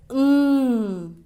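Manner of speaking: म as a long closed-lip "mmm"; drawn-out hum on म, no vowel; held M sound. Shown as a demonstration. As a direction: hum म with lips rolled in. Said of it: "होठों को अंदर की तरफ घुमाकर".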